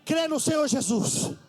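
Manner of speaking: rising into a loud, high sermon shout; fiery preaching. Acted. Preacher calling on the church, building to a high shout.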